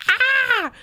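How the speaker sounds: high, loud squeal of cuteness overload; grabbing the cat, a high loud ah; cute aggression squeal. Retold or imitated. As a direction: burst out with a squeezed ah. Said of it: "Just be like".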